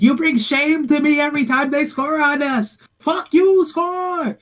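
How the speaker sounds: high-pitched yell, imitating a cursing kid; mock-furious, high kid's yelling. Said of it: "one of the kids was yelling".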